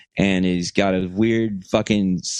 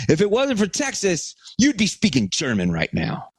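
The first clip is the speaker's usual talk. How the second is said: high retold comeback, dropping toward the end; mock screaming argument; 10 semitones higher than usual. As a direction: deliver a triumphant mock yell. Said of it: "we're screaming at each other".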